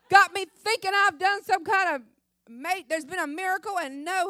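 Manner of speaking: high, loud indignant complaint to God; angry prayer. Retold or imitated. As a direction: refuse God in a huff. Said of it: "Oh, I was so mad".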